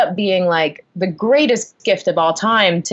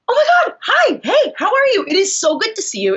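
high-pitched, gushing mock delight; frantically over-friendly re-enacted greeting; manic greeting; 13 semitones higher than usual. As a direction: overdo the thrilled hello, pitched way up. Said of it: "like, manic".

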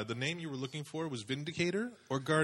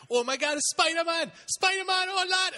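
put-on squealing high kid voice, much higher and louder; shrieking child's delight; excited kids; 6 dB louder than usual. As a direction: scream it like a thrilled little kid seeing Spider-Man. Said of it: "They're like".

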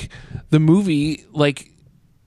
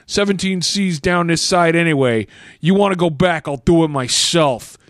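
hammed-up New York dialogue read; mock New York accent. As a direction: read it in broad New Yorkese. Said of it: "in that accent".

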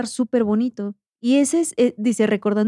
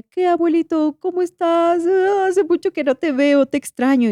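acted fond greeting; 9 semitones higher than usual. Granddaughter greets her late grandfather in a dream, acted tender longing. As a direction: coo it higher, like greeting someone long missed.

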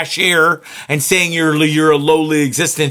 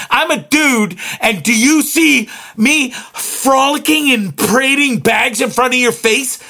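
high-pitched, exasperated outburst; real upset rant; 7 semitones higher than usual. Real, not acted. Fed-up shopper ranting back at the cashier, high and upset. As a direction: rant in high, indignant disbelief. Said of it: "I'm upset about this".

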